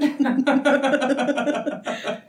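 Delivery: a loud amused laugh; big laugh. Real, not acted. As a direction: burst out laughing, high. Laughs at own story.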